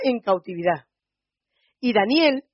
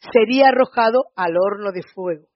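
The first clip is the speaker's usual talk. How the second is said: usual speaking voice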